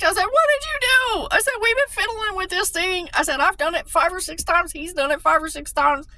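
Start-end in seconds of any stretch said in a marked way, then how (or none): 0.2-1.3 high, loud shocked shout of disbelief; loud, high cry of astonishment; shocked yell. Retold or imitated. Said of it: "I yelled it". 1.6-6.1 high, loud exasperated rant; loud, high complaint about the stubborn breaker; shocked yell. Retold or imitated. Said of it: "I was so shocked".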